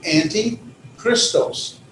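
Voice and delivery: antichristos slowly as "anti, christos"; two separate words. Shown as a demonstration. Teacher showing a compound word, antichristos said as two independent words. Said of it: "pronounce them as two independent words".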